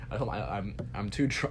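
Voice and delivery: ordinary delivery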